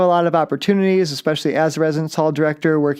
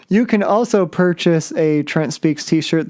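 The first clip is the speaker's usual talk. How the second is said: normal talking voice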